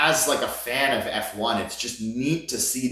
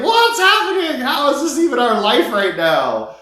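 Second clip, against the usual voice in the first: much higher and louder, overwhelmed; blurting out pure astonishment; amazed disbelief; 13 semitones higher, 6 dB louder than usual. Retold or imitated. Reacting to meeting a champion.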